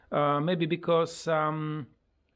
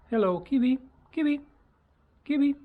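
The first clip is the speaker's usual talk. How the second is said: high, louder pet-calling voice; teaching the parrot; 10 semitones higher, 5 dB louder, faster than usual. Shown as a demonstration. Owner teaching parrot to say hello, high pet voice. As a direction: chirp it up high for a bird. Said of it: "the other word what I'm, uh, teaching him".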